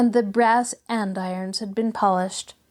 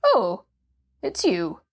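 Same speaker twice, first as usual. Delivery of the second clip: grudging recognition, falling away; ungracious; slower than usual. Acted. Cross patient recognising his young visitor, ungracious. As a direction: break off the anger with poor grace. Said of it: "It broke off not very graciously".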